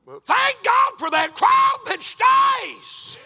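high, loud shouted preaching. Acted. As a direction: belt it out to the rafters. Climax of sermon, shouting.

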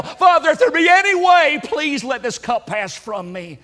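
Jesus begging his Father to spare him; anguished prayer. Acted. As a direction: beg the Father, swelling to a desperate cry. Preacher voices Jesus' second prayer.

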